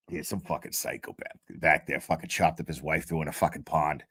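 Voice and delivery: a hint of a deadpan courtroom lawyer, dropped low; Reddington impression. Imitating the lawyer telling the streamer about a case, low deadpan. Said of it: "here's my impression of Reddington".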